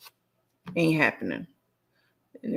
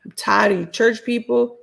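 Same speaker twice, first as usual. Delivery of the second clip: usual speaking voice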